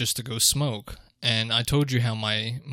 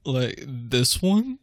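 slow, puzzled "this one?" while pointing; clueless customer; much slower than usual. Retold or imitated. Guest mocks a diner pointing at the menu, slow and puzzled. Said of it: "I'm not just gonna point and be like".

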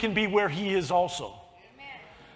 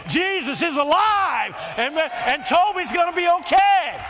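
preaching at full force; 5 semitones higher, 4 dB louder than usual. Acted. Preacher exclaiming.

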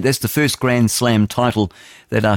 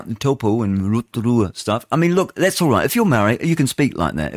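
normal talking voice

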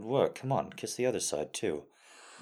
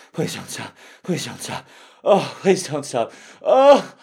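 high, loud ecstatic moans; loud climax cries; 5 semitones higher, 13 dB louder than usual. Acted. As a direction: cry out at the peak.

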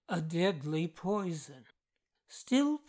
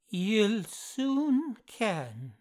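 slow, higher-pitched, emphatic Scottish answer; emphatic Scottish dialect; 4 semitones higher, much slower than usual. A narrator's reading. Accused man answering his employer in a dream, emphatic Scottish. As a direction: draw it out in emphatic Scots. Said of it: "emphatic manner of his answer in the Scottish dialect".

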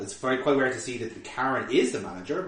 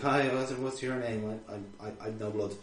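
mumbling; 3 semitones lower, 7 dB quieter than usual. Acted. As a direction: trail off into a soft, dazed stammer. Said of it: "he mumbles about the manager's name".

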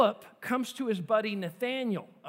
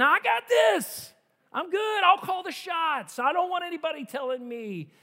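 loud mocking boast, building down at the end; cocky Herod; 6 semitones higher, 4 dB louder than usual. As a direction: puff up like a petty tyrant claiming control. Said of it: "little King Herod taking control".

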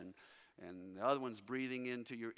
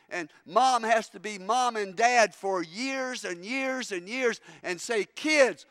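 full-force preaching; 6 semitones higher, 15 dB louder than usual. Acted. Preacher to his congregation about persecuted believers, full-force preaching. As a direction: preach it hard to the room.

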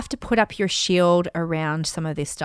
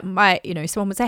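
usual speaking voice

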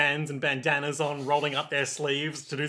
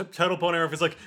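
ordinary talk, nothing marked

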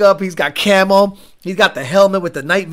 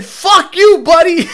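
high, loud imitated outburst; mock losing it; 9 semitones higher, 6 dB louder than usual. Imitating an angry Boston cop to a driver, mock furious. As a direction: gleefully play a cop blowing up. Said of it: "State trooper loses it".

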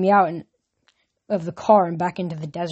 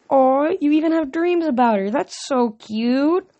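higher, slow cooing aw; cooing goddess; 8 semitones higher than usual. A narrator's reading. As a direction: coo over him like a puppy. Aphrodite coos at Percy.

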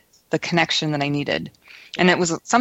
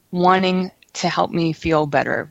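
ordinary talk, nothing marked

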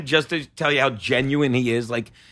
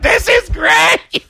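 high, loud, very drawn-out mock cheer; gleeful cheer; 12 semitones higher, 5 dB louder, much slower than usual. Two comics enjoying the colleague's meltdown, gleeful shout. Acts out two delighted onlookers.

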